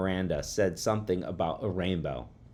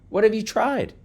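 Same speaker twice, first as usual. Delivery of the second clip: higher, louder questioning teacher; interested teacher; 6 dB louder than usual. A narrator's reading. Teacher asking pupil about experiment, interested. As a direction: ask the pupil with lifted pitch.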